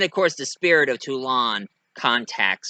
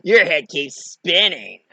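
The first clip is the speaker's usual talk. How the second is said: slow, raised, loud taunt; jeering doll; 4 semitones higher, 5 dB louder, slower than usual. Acted. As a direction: draw out the mockery. Chucky mocks the spinning jester.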